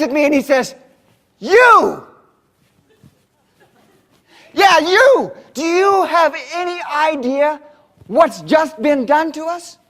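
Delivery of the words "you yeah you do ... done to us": higher, louder, jabbing at you; angry accusation. Retold or imitated. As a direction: point at him and yell it out. Angry farmer confronts the speaker.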